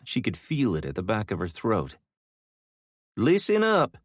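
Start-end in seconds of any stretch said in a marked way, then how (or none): 3.2-4.0 high, loud yell to the room. Acted. Gabby rousing sleepers in the casino with a loud, high yell. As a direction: bark it to wake the sleepers. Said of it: "Gabby yelled".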